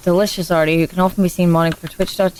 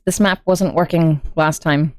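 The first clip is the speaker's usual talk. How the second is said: usual speaking voice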